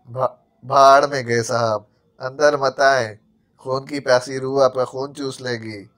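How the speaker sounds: ordinary delivery, nothing marked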